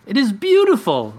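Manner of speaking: shouting back. Acted. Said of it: "I shout back".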